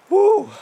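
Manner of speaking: high, short woo of relief; celebratory whoop. Real, not acted. Celebrating the successful hunt.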